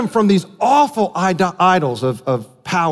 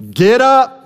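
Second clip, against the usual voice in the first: commanding call; 3 semitones higher, slower than usual. Retold or imitated. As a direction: call the lame man to rise. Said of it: "Jesus said to him".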